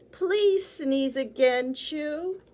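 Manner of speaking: loud slow plea; pleading. Acted. Ice cream seller begging Chew to sneeze, loud. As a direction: coax him to sneeze.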